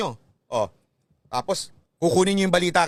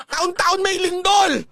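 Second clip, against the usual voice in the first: exasperated shout; 11 semitones higher, 3 dB louder, much slower than usual. Real, not acted. Mocking the psychic's prediction.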